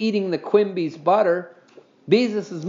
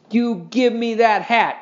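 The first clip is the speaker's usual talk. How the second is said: slow, higher, louder demand; yelled demand; 6 semitones higher, 3 dB louder, slower than usual. A narrator's reading. Henry demands his hat from Scooter, slow raised yell. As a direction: insist on getting it back. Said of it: "yelled Henry".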